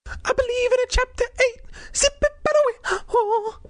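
high squeaky aside. Real, not acted. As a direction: pop up into a tiny cartoon squeak. Reader breaks off, high aside.

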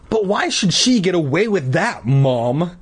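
high, indignant complaint; demanding. A narrator's reading. Boy protesting to his mother, demanding. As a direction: demand it with pitch pushed up. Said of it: "Todd demanded".

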